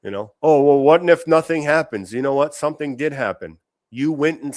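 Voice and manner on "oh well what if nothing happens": pitched up, louder mock doubter; mocking whiny viewer. Streamer mocking a viewer afraid to speak up, loud whiny mock. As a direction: whine it back like a hesitant fan.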